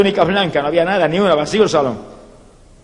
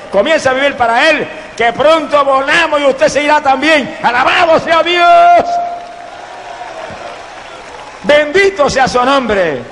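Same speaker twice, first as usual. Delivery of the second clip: fervent altar call; 5 semitones higher than usual. Real, not acted. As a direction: press the listener harder and harder. Altar call building to shout. (llamado al altar que crece hasta gritar)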